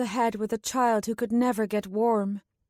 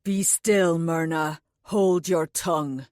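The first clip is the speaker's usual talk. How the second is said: low, firm man's order; stern command; 5 semitones lower than usual. A narrator's reading. Anulan commands Myrna to be silent, low and stern. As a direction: shut her down with authority. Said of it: "able to command her".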